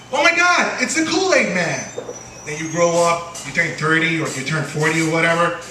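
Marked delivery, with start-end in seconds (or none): 0.1-1.9 put-on high, thrilled little-kid gasp; mock childlike delight at a TV mascot; kid's excitement. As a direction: squeal like a kid seeing his hero. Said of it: "you're a kid, and you say".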